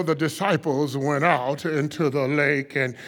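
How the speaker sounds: low, calm preacher opening a sermon; deep, unhurried preacher voice; slow deep preacher. Shown as a demonstration. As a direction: start the sermon low and easy. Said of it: "nice, deep voice".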